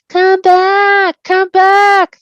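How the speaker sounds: high, loud, unearthly call; dark riders calling high and loud across the ford; eerie calling. Acted. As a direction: call him back like a hunting wraith. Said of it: "they called".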